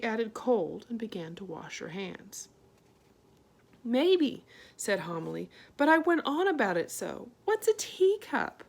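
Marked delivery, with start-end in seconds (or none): 3.8-4.4 a mother's fretful voice, raised; Homily. A narrator's reading. Homily answering her daughter, fretful and high. 5.8-8.6 high, louder, worried; rising fuss over the teacup; fretful mother. A narrator's reading. Homily fussing to Arietti, high and worried.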